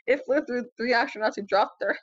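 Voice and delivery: usual speaking voice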